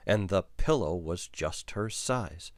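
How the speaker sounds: ordinary delivery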